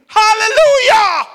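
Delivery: very high, full-voiced hallelujah; joyful shout. Old man over a book in Harvard's library, shouting. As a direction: belt it out in praise. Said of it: "And he shouted in Harvard's library".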